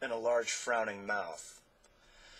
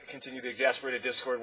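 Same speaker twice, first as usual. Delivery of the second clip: plain reading voice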